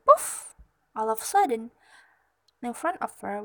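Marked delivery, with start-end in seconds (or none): none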